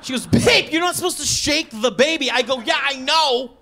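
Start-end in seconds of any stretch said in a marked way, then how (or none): 0.3-2.3 higher-pitched mock scolding; imitating a wife's alarmed reproach; appalled wife. Wife scolds after confession. 2.6-3.5 defensive retort. Retold or imitated. Defensive retort to scolding wife.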